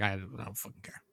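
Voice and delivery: very soft, voiceless mumble; near-whispered shrug; retold indifference. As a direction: toss it away under your breath. Retells his indifference in breakup talk.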